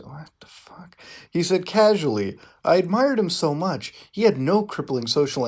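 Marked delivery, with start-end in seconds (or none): 0.0-1.0 a voiceless incredulous whisper; baffled whisper; whispered aside. Real, not acted. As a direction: mutter it under your breath. Aside while reading the quote.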